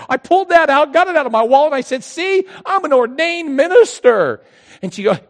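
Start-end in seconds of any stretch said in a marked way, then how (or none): 2.0-4.4 high, loud, slow proof; emphatic. Retold or imitated. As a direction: flash the card proudly. Showing credentials emphatically.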